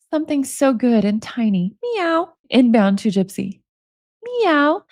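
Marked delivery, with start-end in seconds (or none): 1.8-2.3 high cute kitten meow; a playful high meow; cat meow. Pretend. Reading out the cat sounds. 4.2-4.8 high cute kitten meow; a playful high meow; cat meow. Pretend. Reading out the cat sounds.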